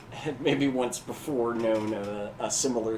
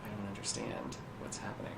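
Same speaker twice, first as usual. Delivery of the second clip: soft, low-key muttering to himself; confused man mumbling under his voice; 3 semitones lower, 9 dB quieter than usual. Acted. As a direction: mumble it, trailing away quieter. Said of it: "so you hear a mumbling".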